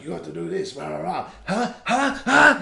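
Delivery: building from high chatter to loud, very high taunting huhs; in-your-face Doctor Hakim. Retold or imitated. Imitating the game's doctor badgering the couple, rising to loud high taunts. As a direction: get right in their face, pushier with each huh. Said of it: "He gets, like, right in their face".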